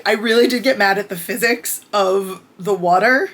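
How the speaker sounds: normal talking voice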